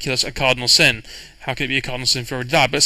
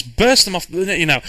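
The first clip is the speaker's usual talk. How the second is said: usual speaking voice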